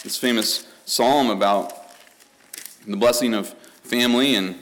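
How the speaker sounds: loud, driving pulpit voice; booming sermon delivery; forceful preaching. Real, not acted.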